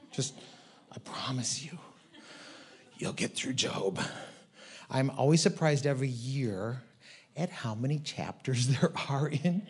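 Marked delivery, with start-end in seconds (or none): 0.1-4.0 soft whispered promise. Real, not acted. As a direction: lean in and whisper it.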